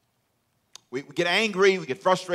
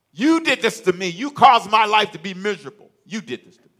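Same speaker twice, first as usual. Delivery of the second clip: loud, higher, blaming cry; accusing outburst; 8 semitones higher, 10 dB louder than usual. Acted. A hurt person accusing the one who wronged them, loudly. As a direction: throw the blame at them. Pastor acts out angry accuser.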